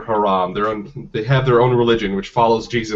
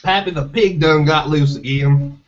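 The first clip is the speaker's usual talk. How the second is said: put-on hillbilly accent; 4 semitones higher than usual. Parodying things hillbillies say, hillbilly accent. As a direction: report it like a backwoods hillbilly. Hillbilly-say examples being acted.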